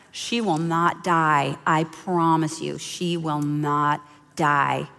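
slow, low, deliberate promise; solemn reassurance. Retold or imitated. Mentor promises the mother baby will live, slow solemn reassurance. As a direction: space out each word to calm her.

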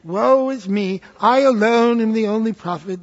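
self-pitying British stage lament; British accent. Acted. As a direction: declaim your woe like a British thespian. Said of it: "What happened to his British accent?".

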